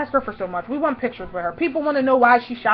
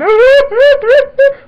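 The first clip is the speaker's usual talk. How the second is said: very high, loud fake wail; mock bawling in pulses; imitated crying; 13 semitones higher, 11 dB louder than usual. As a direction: burst into hammed-up sobs. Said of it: "I would just start crying".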